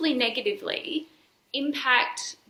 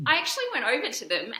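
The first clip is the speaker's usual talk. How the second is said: ordinary delivery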